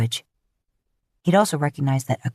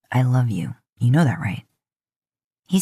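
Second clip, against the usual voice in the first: tender Sam; 4 semitones lower than usual. Acted. Sam voiced lower, tender.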